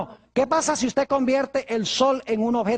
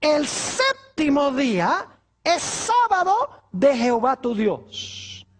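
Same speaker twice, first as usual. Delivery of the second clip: solemn scripture quote; 3 semitones higher, slower than usual. Acted. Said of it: "El mandamiento dice".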